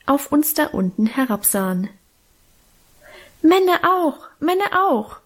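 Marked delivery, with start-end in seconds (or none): 3.4-5.2 put-on high-pitched childish pleading; begging little boy. A narrator's reading. Hermann begs his big sister for a carousel ride, begging. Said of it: "bettelte unser Hermann".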